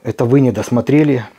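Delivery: usual speaking voice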